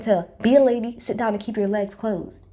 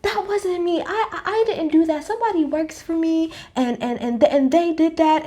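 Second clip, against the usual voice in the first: high, stammering excuse; mock flustered Jen; 6 semitones higher than usual. Mimicking Jen making excuses when shown the phone, high and stammering. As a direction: stumble through a panicky denial. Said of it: "And she was like".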